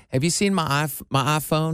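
Southern long flat I in iPhone; Southern accent. Retold or imitated. Man imitating his friend Catherine's speech, Southern accent. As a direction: say iPhone as "ahphone", Southern style. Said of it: "it's that Southern thing".

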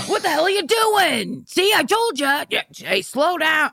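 a hammed-up telling-off; imitating angry dad. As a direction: scold the hurt kid like a gruff father. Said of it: "my dad's response was like".

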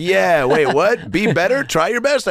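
climbing, high baffled reaction; imitated confusion. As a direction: act out a crowd's rising puzzlement. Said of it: "everyone's like".

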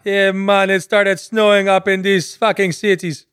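high, slow mock Caribbean accent. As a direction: drag it out in hammed-up Caribbean. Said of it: "the Caribbean".

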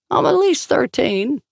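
high, slow, hammed-up wounded pride; mock offended dignity. A narrator's reading. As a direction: pretend to be insulted, playfully. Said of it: "with as much offended dignity as he could pretend".

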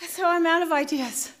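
ordinary delivery, nothing marked

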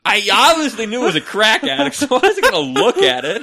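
high, loud, exasperated defence; loud protest. Real, not acted. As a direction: protest at full volume as if it were obvious. Mock-indignant defence of himself.

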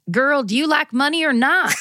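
a hint of a Southern grandma, through laughter; deep south accent. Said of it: "in her thick Southern accent".

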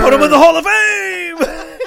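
loud, high, hammed-up cheer; mock fan shout. Joining the joke about fans' hype, mock shout. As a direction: holler it like a delirious fan.

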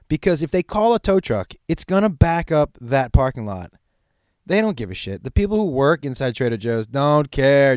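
ordinary delivery

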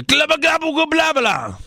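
high, silly babble in a made-up language; mock foreign gibberish. Playing a foreign customer ordering.

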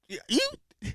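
ordinary talk, nothing marked

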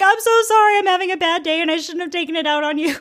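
high-pitched, hurried retold apology; frantic apology. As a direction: blurt out a mock-desperate sorry. Acting out her frantic apology.

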